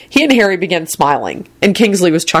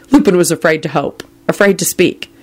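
ordinary delivery, nothing marked

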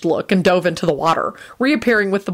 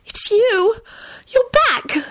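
very high, excited exclamation; joyful relief; much slower than usual. A narrator's reading. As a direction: cry out in delighted relief. Rosie relieved, greets restored friend.

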